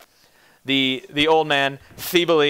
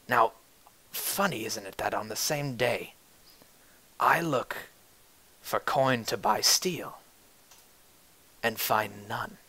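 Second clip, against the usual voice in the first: quiet menace; 7 dB quieter than usual. Acted. Roderick threatening the player quietly.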